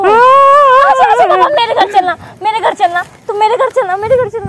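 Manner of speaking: high, loud giving-in; exasperated outburst. Acted. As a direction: throw up your hands and shout yes. (खीझकर ऊंची आवाज़ में)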